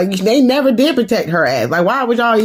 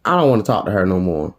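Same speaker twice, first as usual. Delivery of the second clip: cold dismissal; 12 semitones lower, faster than usual. Retold or imitated. Garcelle's cold dismissal, retold.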